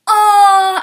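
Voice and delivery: high, loud collective aww; cooing crowd. Retold or imitated. Everyone watching the forehead kiss, loud high coo. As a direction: imitate onlookers gushing.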